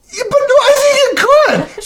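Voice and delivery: put-on high laughing little boy insisting; stubborn kid. Boy insisting to his stepdad at night, high child voice.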